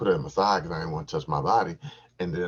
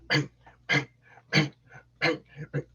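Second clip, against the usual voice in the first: high punchy chanted bangs; rhythmic bang noises; 9 semitones higher, 3 dB louder than usual. Shown as a demonstration. Miming his thrusting rhythm, punchy bangs. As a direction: beat it out like thrusts. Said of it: "I'm just bang".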